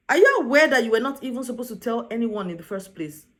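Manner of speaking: normal talking voice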